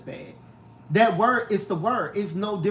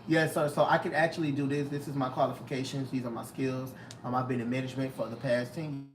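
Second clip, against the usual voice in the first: formal interview voice; 6 semitones lower, 8 dB quieter, faster than usual. Shown as a demonstration. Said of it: "that's different".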